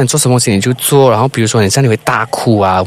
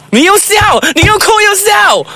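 high laughing yell; laughing shout. Real, not acted. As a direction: crack up while calling it out.

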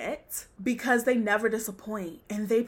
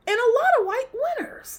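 very high, louder, fast, breaking into laughter; exasperated squeal; 5 dB louder, faster than usual. Real, not acted. Host hammers home the awards complaint, exasperated high squeal. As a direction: squeal the repeat, about to crack up. Third escalating repeat, ends laughing.

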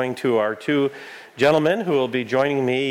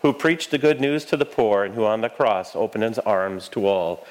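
prayer. Real, not acted. Recites eucharistic prayer.

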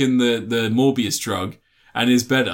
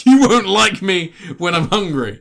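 higher, louder, slow hammed-up menace; mock dramatic threat; 5 semitones higher, 5 dB louder, slower than usual. Mocking Morbius's Hulk-style line to a friend, hammed-up and slow. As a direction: ham up the villain's big threat line. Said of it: "I'll play on that famous Hulk line".

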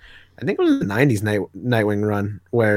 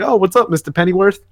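put-on a lightly higher goofy young guy voice; odd voice for Rick; 7 semitones higher than usual. Rick greeting Alfred at the bar, silly put-on voice. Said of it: "I don't know why I made him sound like that".

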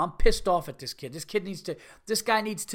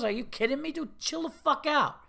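high-pitched, incredulous protest; indignant customer at breaking point; exasperated telling-off; 9 semitones higher than usual. Acted. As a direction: tell him off, stretching the final command. Acted telling-off of sandwich worker.

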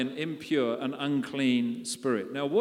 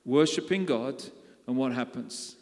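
ordinary delivery

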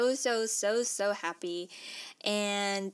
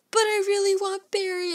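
much higher and louder, mock childish pleading; whiny plea; 11 semitones higher, 7 dB louder than usual. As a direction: beg like a kid denied a puppy. Pleading with the bank.

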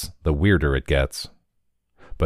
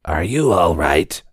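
rasped. A narrator's reading. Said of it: "Chad Thule rasped".

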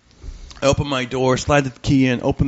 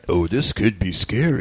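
low, slow, deadpan; deadpan guy callback; 6 semitones lower, slower than usual. Retold or imitated. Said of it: "The dude comes out of the shower".

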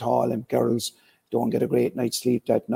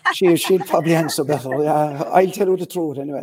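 laughing; 4 semitones higher, 5 dB louder than usual. Real, not acted. Laughs when asked to answer.

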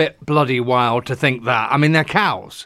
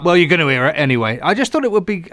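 ordinary delivery

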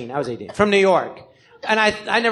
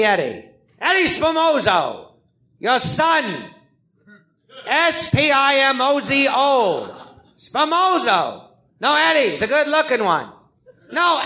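Eddie Spomoso; 4 semitones higher than usual. Acted. Gangster phones his forgetful ma, comically exasperated. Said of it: "it was Eddie Spomoso, sorry, a gangster who has syphilis of the brain".

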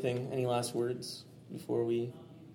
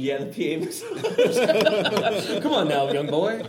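high, slow, laughing and louder; Robin Williams impression; 8 semitones higher, 6 dB louder, much slower than usual. Robin Williams teasing the young PA, high and laughing. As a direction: stretch it out with a cackle, teasing. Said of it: "my Rob Williams impersonation".

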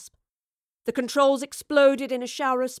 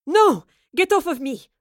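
loud, high shout of resistance; forceful, struggling shout; energetic cry; 7 semitones higher, 9 dB louder than usual. A narrator's reading. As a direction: cry out fighting off a grip. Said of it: "cried with renewed energy".

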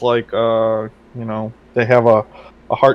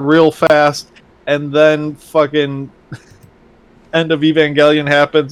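usual speaking voice

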